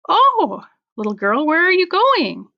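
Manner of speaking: high, sweet-talking wolf; sly wolf. Acted. Wolf luring the girl in the woods, high and sly. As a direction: coax the girl like a tricky wolf.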